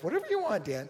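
high-pitched mock wife voice; imitating his wife. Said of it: "Sometimes my wife".

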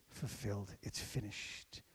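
breathy hushed whisper of "finished". Acted. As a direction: whisper it as if at the cross. Repeats cross words, hushed.

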